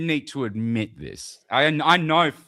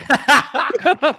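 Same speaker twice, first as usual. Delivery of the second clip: high burst of laughter; 7 dB louder than usual. Real, not acted. Laughs at blue steel joke.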